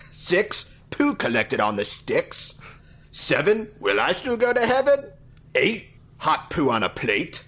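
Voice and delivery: hammed-up high Cockney counting rhyme. Host counting to a thousand for the show in mock Cockney. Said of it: "I'll try to do the, uh, the Cockney accent".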